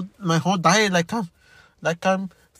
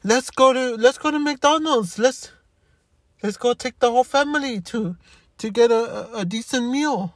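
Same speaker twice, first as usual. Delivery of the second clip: high, bright mock-cheerful invitation; mocking cheerful fifty-year-olds; 6 semitones higher than usual. Speaker mocks healthy older people, high mock-cheerful voice. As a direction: chirp it like a carefree middle-aged friend. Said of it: "and they can say".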